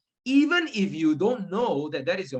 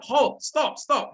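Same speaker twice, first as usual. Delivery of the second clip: higher, loud call of stop; mock shout; 8 semitones higher, 3 dB louder, much slower than usual. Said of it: "So Angulimala shout".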